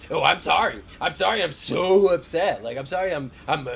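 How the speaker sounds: mock whiny apology. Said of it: "she literally was like".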